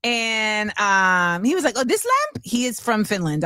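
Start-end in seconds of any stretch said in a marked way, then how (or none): 1.8-2.4 put-on high, questioning stab at a Finnish accent; attempted Finnish accent. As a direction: imitate a surprised Finn, accent barely there. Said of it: "I can't do the accent".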